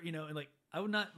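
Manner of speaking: normal talking voice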